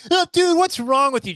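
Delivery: high, loud disgusted jab; high mock disgust. Mocking a comic he watched, high and disgusted.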